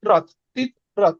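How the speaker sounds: তিত্রাত broken into tit, pause, rat; syllable by syllable. Shown as a demonstration. Teacher drilling the pronunciation, তিত্রাত as two separate beats, tit-rat. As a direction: split তিত্রাত cleanly after the first t. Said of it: "উচ্চারণটা দেয়া আছে তিত্রাত".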